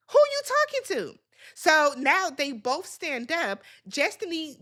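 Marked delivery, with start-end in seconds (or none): 0.1-1.2 indignant. Real, not acted. Indignant at Kimmy.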